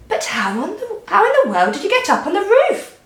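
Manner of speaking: high astonished cry. A narrator's reading. Mother crying out in disbelief at Grandma on the roof. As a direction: blurt it out in disbelief. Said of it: "cried the mother".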